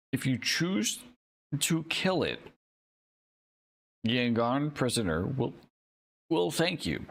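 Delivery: talking through hiccups; hiccuping between words. Real, not acted. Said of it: "I have it, the hiccups".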